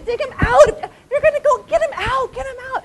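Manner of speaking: put-on high, loud, urgent plea to the boy; urgent pleading. Urging the German boy to rescue the father, high excited plea. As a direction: beg him to get the father out, high and pressing. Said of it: "And of course I went".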